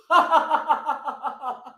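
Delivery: light laugh going down; chuckling laugh. Shown as a demonstration. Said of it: "chuckle it down with a laugh".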